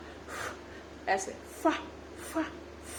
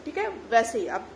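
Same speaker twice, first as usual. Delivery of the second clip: ordinary delivery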